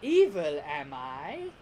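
mock-offended villain; loud swoop on the first word, then drop low; Frieza. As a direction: feign innocence in a villain voice. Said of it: "and then Frieza's like".

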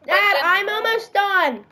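calling through the house. Real, not acted. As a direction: shout to the next room. Calling to dad in another room.

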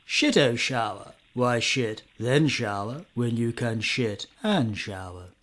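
put-on plummy upper-class English accent; posh voice. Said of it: "all it really needs to sell this idea is a posh voice".

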